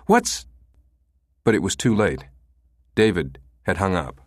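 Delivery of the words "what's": cut-off cry. Acted. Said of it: "she cried".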